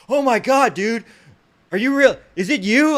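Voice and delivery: high, loud imitation of an excited fan; starstruck fan. Man at the door recognizes the officer, mock starstruck.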